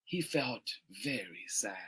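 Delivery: very soft, low, sinking voice; quietly heavy-hearted storytelling; sad narration. A narrator's reading. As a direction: let it drop to a hushed, sorrowful murmur. Narrates the boy's sadness.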